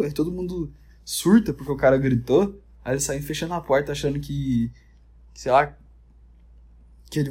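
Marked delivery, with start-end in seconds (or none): none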